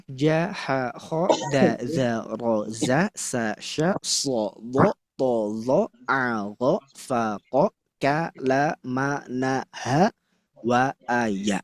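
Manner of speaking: A, ba, ta said with the jaw dropped open; open-jaw fathah drill. Shown as a demonstration. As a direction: chant each syllable with an open jaw. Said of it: "saya baca dulu".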